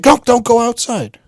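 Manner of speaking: high, urgent imitated warning; mother yelling. Mother stopping child going to the backyard, imitated urgency. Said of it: "my mother just calls and yells".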